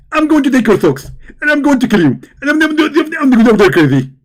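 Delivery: high, loud preachy mimic; Zakir Naik. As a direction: lecture like an excited preacher. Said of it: "Zakir Naik".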